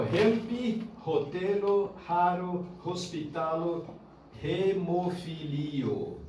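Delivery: "help, rotelo, raro" with aspirated h onsets; word by word, slowing into a drawn-out "hemofilio"; English h demonstrated. Shown as a demonstration. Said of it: "Que ele na anga língua, help".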